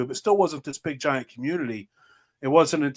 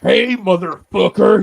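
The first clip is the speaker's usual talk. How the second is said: slow, louder mock drunk yell; 6 semitones higher, 8 dB louder than usual. Friend imitating his drunk video rant, mock yell. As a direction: bellow it like a drunk picking a fight. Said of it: "soon as he's like".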